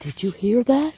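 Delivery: high, loud, thrilled question; excited. Real, not acted. Asking Dale about the close call, high excited. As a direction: burst out with disbelief.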